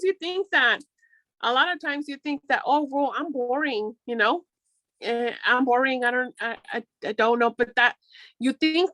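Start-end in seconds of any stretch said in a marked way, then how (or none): none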